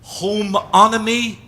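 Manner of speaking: Homeology said high, loud and slow; Homeology stretched out and punched; emphatic coinage. Shown as a demonstration. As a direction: present Homeology slowly as a new word. Coining a word for the audience.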